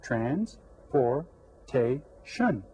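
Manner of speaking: very slow, a clear pause between syllables; separated syllables. Shown as a demonstration. Teacher shows the four syllables, very slow and separated. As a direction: break "Transportation" into four separate beats. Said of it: "Did you get four syllables?".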